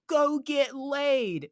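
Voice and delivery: high, slow drawn-out holler; stretched-out retold jeer; mock yell. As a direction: imitate a heckler calling out. Mimics lady yelling a parting insult.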